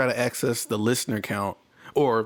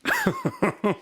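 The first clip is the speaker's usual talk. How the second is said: high laugh. Real, not acted. Laughing at feeling good counter.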